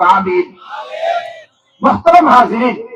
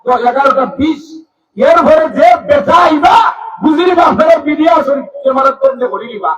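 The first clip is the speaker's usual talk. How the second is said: high, loud sermon shout; fiery waz preacher rallying listeners; preaching at full force; 10 semitones higher, 4 dB louder than usual. Acted. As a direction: rouse the crowd at full voice. Ultimatum shouted to crowd.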